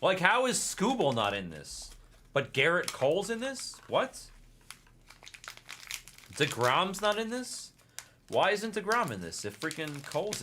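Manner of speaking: loud, high, disbelieving protest; incredulous outrage. Real, not acted. Collector outraged at missing players, loud disbelief. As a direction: demand an answer, stunned and indignant.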